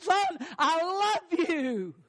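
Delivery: high, very slow, impassioned cry; acted tearful declaration. Father's third try at convincing his son, acted impassioned cry. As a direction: draw each word out with full heart. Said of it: "with weeping and crying".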